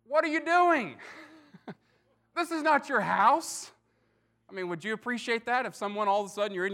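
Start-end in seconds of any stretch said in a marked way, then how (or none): 0.1-3.7 high, loud startled protest; mock outrage at strangers tearing open his roof; indignant homeowner. Homeowner yells at men breaking his roof, high and indignant. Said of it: "you imagine someone might have looked up and said".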